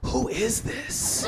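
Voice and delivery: slow, soft imitation of a suspicious caller; hushed suspicion. Woman confronting the stranger on her call, hushed suspicion.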